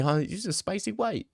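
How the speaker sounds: soft voice. Retold or imitated. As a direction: redo her as a soft-voiced girl. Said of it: "she had a soft voice".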